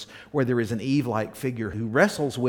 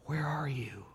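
tender whisper; 9 dB quieter than usual. Acted. God calling to fallen Adam.

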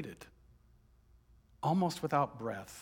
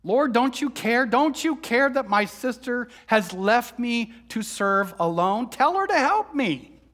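loud and higher, bitter pleading to the Lord; demanding and wounded, voice climbing to a cry; angry outburst; 7 semitones higher, 7 dB louder than usual. Acted. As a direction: let the resentment burst out at him. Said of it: "with anger in my voice and bitterness in my mouth".

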